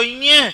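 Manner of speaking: न्य hummed through the nose; nasal sound. Shown as a demonstration. Teacher models the last letter of the row, nasal न्य. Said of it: "नाक में बोलो".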